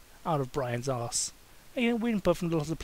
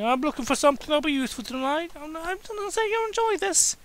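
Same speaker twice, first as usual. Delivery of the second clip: much higher, sly and teasing; teasing Kane voice; 13 semitones higher than usual. Acted. Kane teasing Daniel while searching the drawer, high sly voice. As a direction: tease him about tonight.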